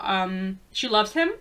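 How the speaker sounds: ordinary talk, nothing marked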